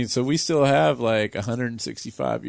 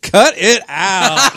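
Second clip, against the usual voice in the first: laughing through a stern line; slow, high, cracking up; 7 semitones higher, 9 dB louder, much slower than usual. Retold or imitated. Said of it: "and he's like".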